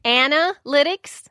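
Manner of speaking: ANALYTICS slowly, short-A AN at the start, questioning; tentative pronunciation attempt. Shown as a demonstration. Student checking how to say it, tentative slow AN-alytics. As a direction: try out "AN-uh-LIT-ics?" with a rising end. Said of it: "how am I supposed to say it?".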